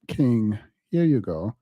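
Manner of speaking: ordinary talk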